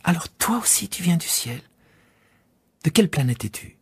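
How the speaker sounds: put-on child asking the pilot's planet; curious little prince. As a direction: ask with wide-eyed curiosity. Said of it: "Puis il ajouta".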